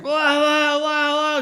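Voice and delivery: high, loud nonsense yelling; loud mocking wah wah yelling. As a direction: parody a driver screaming. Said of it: "is screaming at me".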